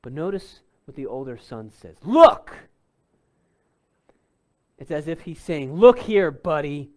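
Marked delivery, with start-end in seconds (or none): 2.0-2.7 sharp, loud, rising bark of Look; older son snapping at his father, loud and rude; disrespectful shout. Retold or imitated. Older son rebuking his father, a sharp loud shout. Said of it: "a sign of absolute disrespect". 5.7-6.9 disrespectful retort. Retold or imitated. Son mocking his father, loud and dismissive. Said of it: "a sign of absolute disrespect".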